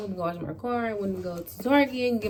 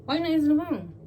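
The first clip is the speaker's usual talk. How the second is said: re-enacted annoyance, rapid and raised; irritated; 6 semitones higher, faster than usual. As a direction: act out fed-up phone nagging, pitched up. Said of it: "now I'm getting irritated".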